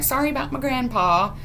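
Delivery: a hint of sheepish girlish apology; apologetic girl. [name] apologizing for her grandpa, lightly girlish voice.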